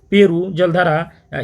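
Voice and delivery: Peru जलधारा drawn out slowly, each syllable spaced; slow drill answer. Shown as a demonstration. Teacher drilling the answer for students, slow and deliberate. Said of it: "क्या रख दिया गया?".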